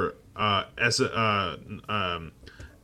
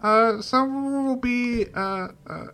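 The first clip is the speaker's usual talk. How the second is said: higher, dopey George Lucas voice; George Lucas impression; 10 semitones higher than usual. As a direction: pitch the movie like a clueless Lucas. Impersonating George Lucas pitching.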